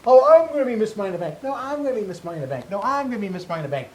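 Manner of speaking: high, loud, fast mock bickering; mocking squabbling wrestlers. Podcast host mimicking eight women squabbling over the title. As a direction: sneer it out like squabbling rivals each claiming the prize. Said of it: "the eight women squabbling".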